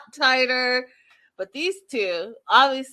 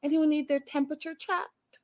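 flirty nurse quote; 6 semitones higher, 3 dB quieter than usual. Retold or imitated. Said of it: "Chantel's like".

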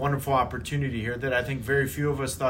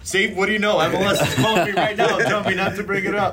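joking loudly; 6 semitones higher, 6 dB louder than usual. Acted. Joking MLS is calling him.

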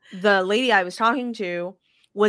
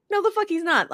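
incredulous denial; 11 semitones higher than usual. Retold or imitated. Repeats denial much higher.

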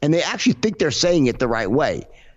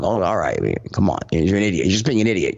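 lower, scoffing retort; dismissive; 6 semitones lower, faster than usual. Retold or imitated. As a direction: brush him off with scorn. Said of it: "I'm like".

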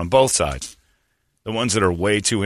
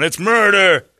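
protester shout; 11 semitones higher, 6 dB louder, slower than usual. Retold or imitated. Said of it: "outside going".

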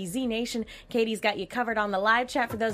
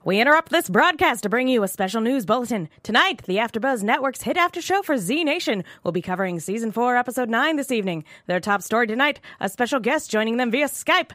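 put-on loud, old-time radio announcer; 1940s newscaster voice; 4 dB louder than usual. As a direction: boom it like a wartime news bulletin. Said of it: "the nineteen forties newscaster voice".

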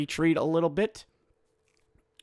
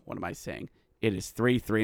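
ordinary talk, nothing marked

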